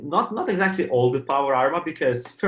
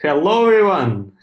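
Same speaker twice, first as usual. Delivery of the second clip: bright, loud, high show opener; signature greeting; 6 semitones higher, 9 dB louder than usual. Real, not acted. Podcast cohost greeting the listeners with his loud signature hello. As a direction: belt out the trademark hello. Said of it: "saying hello everyone in the same type of voice every time".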